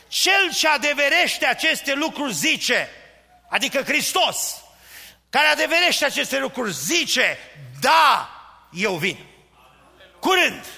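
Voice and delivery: shouted, high-pitched revival preaching; preaching at full force. Acted. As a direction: cry it out to the whole church. Preacher climaxes, shouting Christ's coming. (predică strigată, cu toată forța)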